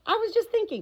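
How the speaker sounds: high, light parrot voice, musing. A narrator's reading. Parrot Polynesia to the doctor, high musing parrot voice. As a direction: pitch it up like an old parrot. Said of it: "said the parrot".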